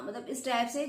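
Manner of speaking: ordinary talk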